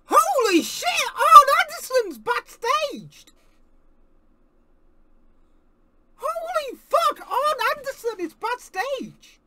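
loud, high shocked yelling; excited shout. Real, not acted. Fan yelling at the screen as a legend appears, shocked excited shout. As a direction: shout it out in disbelief. Live reaction to surprise appearance.

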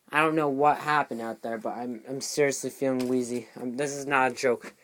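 usual speaking voice

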